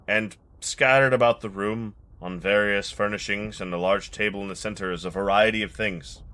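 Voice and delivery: normal talking voice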